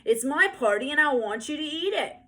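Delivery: put-on high, loud furious little girl; loud bossy tantrum voice; furious child. A narrator's reading. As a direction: insist it's your party at full volume. Ramona's tantrum continues.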